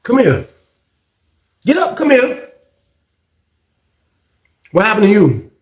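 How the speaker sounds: high calling out voice; barking at someone to get up; mock guard call.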